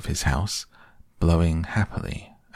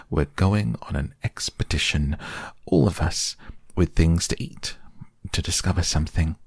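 ordinary delivery

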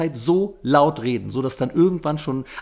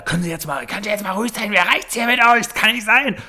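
mock annoyed complaint; 8 semitones higher than usual. Voicing an annoyed moviegoer.

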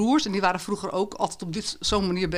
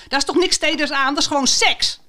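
put-on indignant teen; 8 semitones higher, 5 dB louder than usual. [name] keeps protesting loudly.

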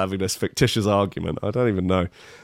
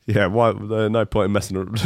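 usual speaking voice

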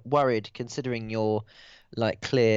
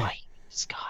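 hushed whisper; 8 dB quieter than usual. Acted. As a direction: whisper so no one hears. Whispering in character.

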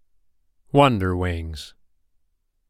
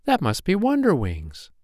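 high-pitched eager realisation; light, high child-fairy excitement; excited little fairy; 10 semitones higher than usual. A narrator's reading. Poppy Pink waking to the visitor's arrival, high and eager.

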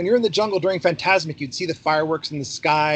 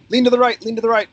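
calling out; 7 semitones higher, 5 dB louder, faster than usual. Retold or imitated. Skipper calling out to boat guests.

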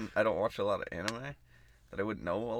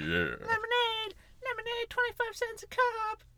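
put-on very high, squeaky child's voice; kid lemonade seller; slower than usual. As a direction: call out slowly like a little kid at a stand. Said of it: "whiny teenage voice for a while".

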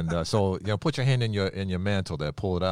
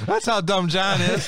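laughing tease; 11 semitones higher, 5 dB louder than usual. Real, not acted. Teasing cohost, laughing.

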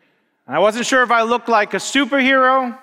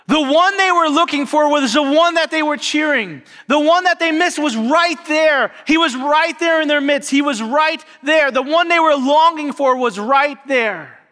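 rising preaching; 8 semitones higher, 3 dB louder than usual. Acted. Preaching climax.

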